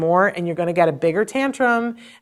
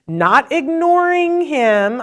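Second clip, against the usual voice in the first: ordinary delivery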